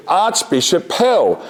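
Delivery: high, stretched-out mimed call. Retold or imitated. Office worker naming the caller from the window, mimed calling out. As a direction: announce the name slowly across a distance.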